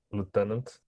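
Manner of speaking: ordinary talk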